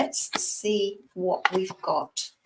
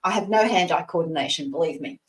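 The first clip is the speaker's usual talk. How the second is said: ordinary talk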